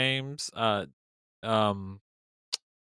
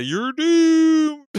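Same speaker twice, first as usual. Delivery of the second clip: high, loud spooky warning; ominous doom voice; 7 dB louder than usual. Retold or imitated. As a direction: wail it like a doom prophet. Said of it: "Trey Parker did the".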